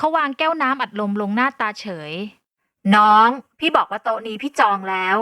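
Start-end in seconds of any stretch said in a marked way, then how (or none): 2.5-5.2 louder, slow, irritated repeat; เริ่มโมโห; getting angry. A narrator's reading. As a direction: repeat it with rising anger. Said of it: "ฉันเริ่มมีน้ำโห".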